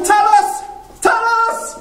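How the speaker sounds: high shout down from the roof; calling out. Acted. Daedalus shouting down after the falling nephew. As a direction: call the boy's name in panic.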